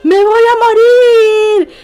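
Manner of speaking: yelling at a school friend in the crowd; desperate scream. Retold or imitated. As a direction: scream it at the top of your lungs. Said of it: "le grité sin ningún miramiento".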